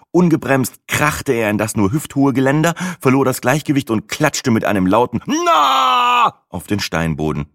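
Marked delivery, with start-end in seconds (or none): 5.2-6.4 high, loud yelp while falling; startled shout. A narrator's reading. Old man yelping as he falls, shout. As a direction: let out a sudden cry.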